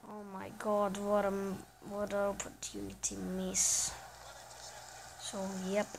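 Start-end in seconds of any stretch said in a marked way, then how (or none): none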